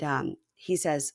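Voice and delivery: ordinary talk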